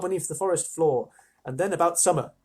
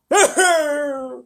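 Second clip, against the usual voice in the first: high laugh; 12 semitones higher, 9 dB louder than usual. Real, not acted. Reader's laugh.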